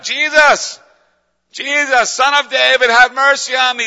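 loud, high-pitched full-voiced shout; crying out. Blind Bartimaeus calling to Jesus for mercy, crying out. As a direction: yell it at the top of your lungs. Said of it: "He's crying, yelling at the top of his lungs".

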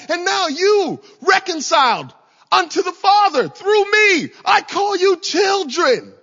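high, loud shouted preaching, slow on key words; Jesus crying out. Acted. Preacher voicing Jesus calling believers his children, loud shout. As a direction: cry out to lift them toward victory. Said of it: "He would cry out".

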